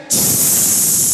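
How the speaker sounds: a long, slow unvoiced hiss; snake hiss. Serpent introduces himself with a snake hiss. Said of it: "Quand il dit Serpent, il dit".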